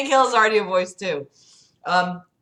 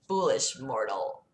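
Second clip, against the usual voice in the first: lower, slow sinister devil; 6 semitones lower, 9 dB quieter than usual. Acted. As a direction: growl it like the devil himself. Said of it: "Yeah, Gabriel, sorry".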